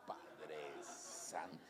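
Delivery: drawn-out, stunned low exclamation; astonished slow sigh. Retold or imitated. Preacher recalling his dismay at the long line, slow and low. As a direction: let it out slowly, dismayed.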